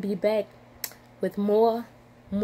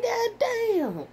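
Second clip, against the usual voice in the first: cry; 13 semitones higher than usual. Real, not acted. Upset at forgetting her name.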